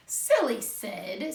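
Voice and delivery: ordinary delivery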